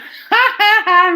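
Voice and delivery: burst of laughter. Real, not acted. Laughing at ladder.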